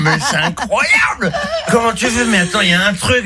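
high, laughing disbelief; stunned drunk friend laughing in disbelief; amazed laughing. Acted. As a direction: gasp in delighted amazement.

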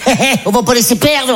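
high, put-on greedy mum; mother's voice. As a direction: impersonate mum pouncing on leftovers. Mother taking the plate, acted out.

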